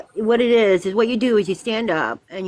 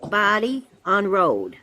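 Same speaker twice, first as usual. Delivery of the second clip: slow dictation; much slower than usual. Shown as a demonstration. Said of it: "you're writing down".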